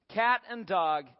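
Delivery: ordinary talk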